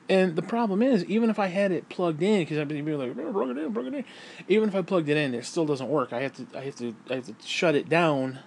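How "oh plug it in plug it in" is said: much higher sing-song mimicry; mocking people's advice to plug it in. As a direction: parrot the advice in a silly high voice.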